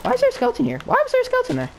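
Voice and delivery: very high-pitched alarmed squeak; startled squeal. Real, not acted. Gamer surprised by a skeleton, startled squeal. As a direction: shriek the question way up high.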